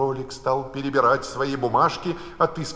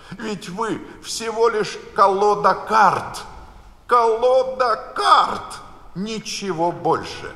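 defiant cry; 10 semitones higher, 5 dB louder, slower than usual. A narrator's reading. Alice defies the whole card deck.

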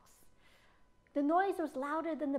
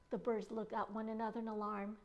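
usual speaking voice

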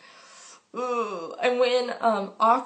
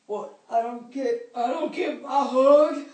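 mock whiny awkward boy; 3 semitones higher, 6 dB louder than usual. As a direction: whimper for a hug. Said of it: "he's like".

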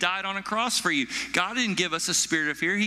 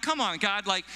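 retold mad complaint; 5 semitones higher than usual. Preacher retelling his complaint to God, mock exasperation. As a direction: plead indignantly, pitch climbing to the end. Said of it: "I got mad".

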